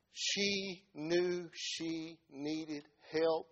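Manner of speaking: each word spaced out with weight; very slow, deliberate. Real, not acted. Preacher telling of the woman's need, slow and deliberate. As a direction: let every word land separately.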